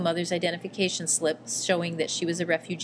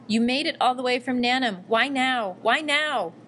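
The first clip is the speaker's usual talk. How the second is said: a grieving outburst, higher and building; bursting out in grief; 5 semitones higher, 3 dB louder than usual. A narrator's reading. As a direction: break out in tearful disbelief, rising toward the end. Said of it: "Then she burst out".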